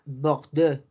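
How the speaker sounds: French "Bordeaux", throaty R and rounded final vowel. Shown as a demonstration. Teacher giving another example, French "Bordeaux", a little nasal. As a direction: say "Bordeaux" as bokh-DEU. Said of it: "un poquito más nasal".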